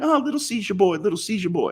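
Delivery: high, put-on taunting movie voice; a mocking sing-song taunt pitched high; Eddie Murphy impression. Mocking the movie line he heard, high taunting voice. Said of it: "It was, like, Eddie Murphy going".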